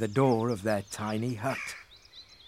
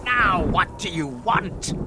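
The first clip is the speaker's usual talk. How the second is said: slow, raised, irritated demand; angry shout; 5 semitones higher, slower than usual. A narrator's reading. Magic fish to pestering Frederick, angry demand. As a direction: demand it crossly, drawing out the words. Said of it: "shouted the magic fish angrily".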